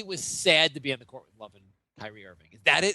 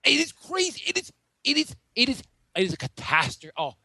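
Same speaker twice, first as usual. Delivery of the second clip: angry rant; 2 semitones higher than usual. Pretend. Pundit answering why he is already angry, hammed-up outrage. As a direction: sputter with indignation, repeating yourself. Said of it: "Why are you already angry?".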